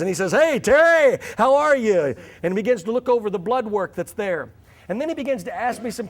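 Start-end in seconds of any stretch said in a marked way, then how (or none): 0.3-2.1 put-on louder, higher, cheery "Hey, Terry" as to an old friend; hearty greeting. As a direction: greet him like a long-lost friend. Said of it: "it's like we're long-lost friends".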